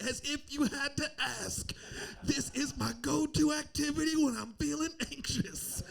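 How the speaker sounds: high-pitched, hammed-up anxious outburst; mock frantic eagerness. Husband answering wife in labor, mock frantic keenness. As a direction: push it up high, overdoing the eagerness.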